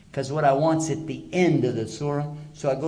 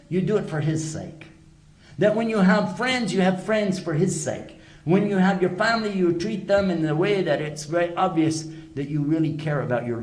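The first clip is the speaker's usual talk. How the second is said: higher and louder, pressing each point; impassioned preaching; 6 semitones higher, 4 dB louder than usual. Real, not acted. Preacher telling listeners to do everything for God's sake, higher and louder. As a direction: preach with rising fervour, hammering each point.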